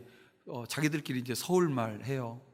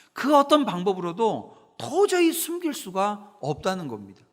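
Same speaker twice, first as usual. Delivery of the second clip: fervent preaching; 6 semitones higher, 6 dB louder than usual. Acted. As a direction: drive the point home to the congregation. Preacher at full force. (힘 있는 설교조)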